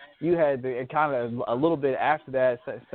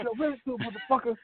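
mock landlord yell; 11 semitones higher, 3 dB quieter than usual. Quoting landlord yelling at tenants.

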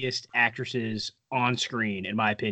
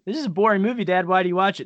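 put-on high, whiny young boy; a bored little kid whining at his dad; young son complaining to his dad that the movie is boring; 6 semitones higher, 3 dB louder, much faster than usual.

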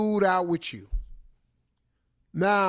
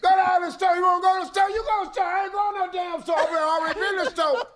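high-pitched comic shouting match; hammed-up, high yelled back-and-forth; 10 semitones higher, faster than usual. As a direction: holler over everyone in the house. Acting loud crowded-house argument.

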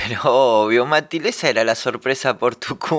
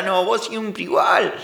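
ordinary delivery

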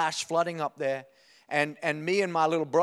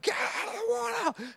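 mum yelling. Retold or imitated. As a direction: imitate mum hollering down to the kids. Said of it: "she can yell, my mum".

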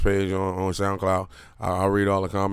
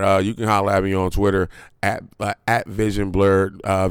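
ordinary talk, nothing marked

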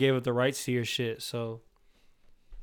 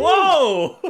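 surprised whoa; 14 semitones higher, 11 dB louder than usual. Retold or imitated. Quoting surprised reaction.

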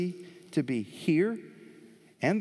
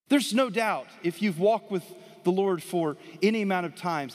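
full-force preaching; 4 semitones higher than usual. Acted. Pastor urging the congregation, raised preaching voice. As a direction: lift the sermon back up.

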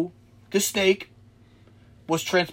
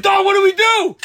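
high, loud retold panic; comic desperate shout; panicked plea; 14 semitones higher, 7 dB louder than usual. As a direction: yell to your friend for a plan. Retells panicked plea to friend.

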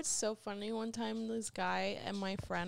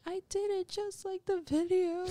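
high, tearful wail; mock crying; 10 semitones higher, 5 dB louder than usual. Woman mimics a man crying after sex, mock sobbing. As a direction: whine it through fake sobs.

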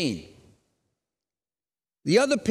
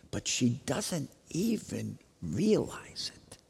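whisper; 6 semitones lower, 8 dB quieter, slower than usual. Acted. Hushed aside about Jesus near.

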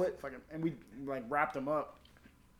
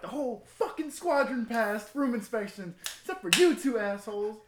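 imitated angry dressing-down, pitched way up; mock chewing-out; 9 semitones higher, 8 dB louder than usual. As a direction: holler it like a fed-up inspector. Retelling inspectors chewing them out.

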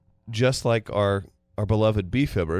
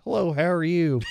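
bright higher friendly hello; imitating a polite clerk; polite greeting; 8 semitones higher than usual. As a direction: mimic a cheerful clerk. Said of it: "the person looks you in the eye and says".

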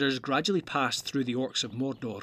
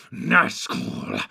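louder, much lower Nazgul with a shudder; louder fearful hiss; shivering; 11 semitones lower, 6 dB louder than usual. Acted. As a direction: spit out Nazgul with foul relish. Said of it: "shivering and licking his lips".

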